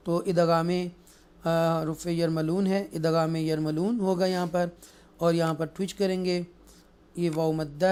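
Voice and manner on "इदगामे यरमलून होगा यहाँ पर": ordinary delivery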